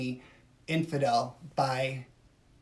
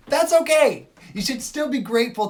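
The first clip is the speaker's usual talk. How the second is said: much higher and louder, insisting; emphatic shout; 9 semitones higher, 10 dB louder than usual. Real, not acted. Streamer pleading with viewers to back conservatives, shouting. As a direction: nearly yell that it's okay.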